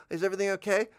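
gasp, then high mock-worried question; breathless friend. Friend arriving after jogging over, mock breathless concern.

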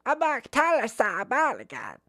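put-on very high, babbling toddler. Shown as a demonstration. Voice teacher overdoing the kid character, toddler voice. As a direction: babble it in a tiny squeaky voice. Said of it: "That's a little toddler".

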